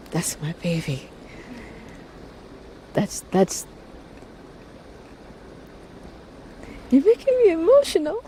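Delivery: fighting back tears while speaking; getting emotional. Real, not acted. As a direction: voice breaking with emotion, rising high at the end. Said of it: "Sorry, I'm getting emotional".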